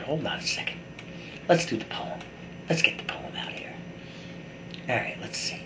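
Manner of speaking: soft, half-whispered to himself; muttered aside. Real, not acted. Searching for the poem, mutters softly to himself. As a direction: mumble it while rummaging for the page.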